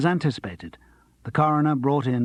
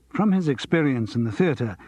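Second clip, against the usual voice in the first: usual speaking voice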